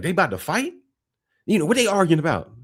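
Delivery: mock alarm. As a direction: imitate a startled bystander. Outsiders alarmed by loud family talk.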